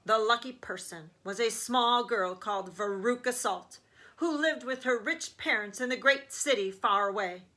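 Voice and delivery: plain reading voice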